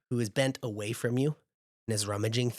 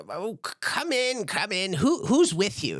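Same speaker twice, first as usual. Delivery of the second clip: put-on a much higher old granny, fondly scolding; 8 semitones higher than usual. Granny scolding her grandson Jinx, high and fond. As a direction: play the fussing giant grandma, pitched way up. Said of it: "And she's like".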